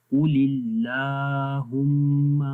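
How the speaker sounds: اللَّهُمَّ with a thin لا after heavy قُ; slow tajweed of قُلِ اللَّهُمَّ; light lam recitation. Shown as a demonstration. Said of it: "باریک پڑھیں گے".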